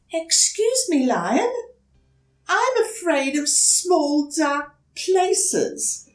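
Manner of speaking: put-on high, hesitant small-child voice; young turtle politely asking a stranger for help; timid little turtle. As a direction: ask shyly, a little scared. Franklin asks the lion.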